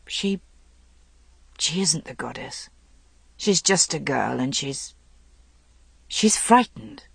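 hoarse, fighting the words. A narrator's reading. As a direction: force the words out haltingly. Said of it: "hoarsely, fighting the words".

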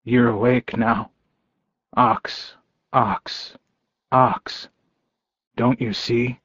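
understated, voice close to cracking; hushed, mildly broken plea; cracked voice. A narrator's reading. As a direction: plead softly, trailing into near-whisper. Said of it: "His voice cracked when he said".

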